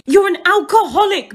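ordinary talk

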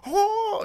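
very high, loud mock scream; shrieked "oh" in imitation; Philip Rivers screaming. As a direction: imitate an overexcited squeal. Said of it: "He's screaming".

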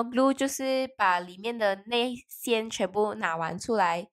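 usual speaking voice

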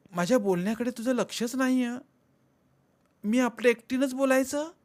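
wife scolding, voice climbing; complaining wife. Acted. Wife complains he ignores her.

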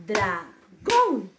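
Dragón split, GÓN punched loud; stress on last syllable. Shown as a demonstration. Teacher showing the tonic syllable, Dragón with a forceful GÓN. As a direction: DRA held back, then hit GÓN hard in Dragón. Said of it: "tenía mayor fuerza de voz".

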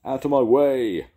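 high, loud pirate roar. A narrator's reading. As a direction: bellow at the crew as you push past. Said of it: "roared Jake".

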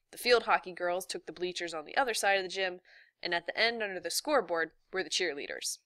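plain reading voice

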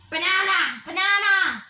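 loud calls. Pretend.

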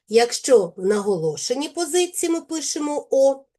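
ordinary talk

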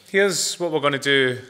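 usual speaking voice

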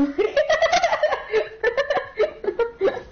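high-pitched giggling fit; real laughter. Real, not acted. Gamer cracking up at a friend's play, real high laughter.

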